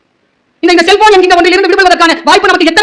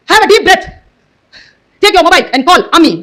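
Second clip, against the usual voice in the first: slow, spaced-out commands of a female device; stern assistant voice; 3 semitones higher, much slower than usual. Retold or imitated. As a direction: imitate a voice assistant giving strict orders. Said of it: "அவ்வளவு கடுமையா பேசுறா". (கண்டிப்பான பெண் குரல் உதவியாளர் போல)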